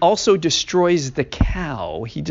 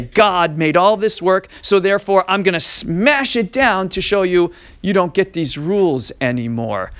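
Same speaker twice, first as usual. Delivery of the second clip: Moshe declaring; 5 semitones higher, 6 dB louder than usual. Acted. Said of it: "like, to say".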